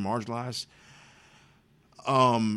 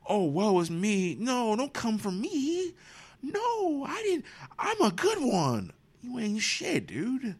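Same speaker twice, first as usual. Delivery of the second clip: much higher, slow whining self-pity; mock whiny victim; slower than usual. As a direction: whine like a wounded old man begging sympathy. Mocking Gilliam's self-pity.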